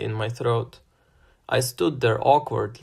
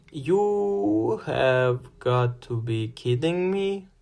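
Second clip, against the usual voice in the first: slow, flat disbelief; flat deadpan; 5 semitones higher, much slower than usual. A narrator's reading. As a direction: say it dead flat, slowly. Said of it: "I said flatly".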